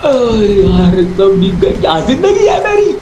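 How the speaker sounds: high, dramatic whining despair; exasperated cry climbing very high; wailing lament. Acted. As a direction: wail over your ruined laundry. Laments that all clothes are soaked.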